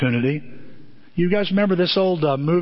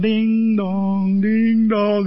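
church bells; 4 semitones higher, 3 dB louder, much slower than usual. Retold or imitated.

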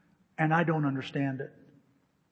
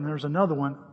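ordinary talk, nothing marked

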